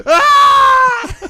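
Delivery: high, loud shriek of fright; retold scream, high and loud; startled scream. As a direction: shriek as if woken by a clown. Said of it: "I scream".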